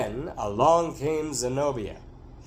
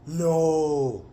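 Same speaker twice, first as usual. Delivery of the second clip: long drawn-out gasp of "no"; wide-eyed disbelief; delighted disbelief; 3 dB louder than usual. A narrator's reading. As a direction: react amazed to juicy gossip. Wife hears gossip, eyes wide.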